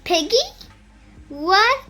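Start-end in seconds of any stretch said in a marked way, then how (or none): none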